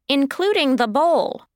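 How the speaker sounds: usual speaking voice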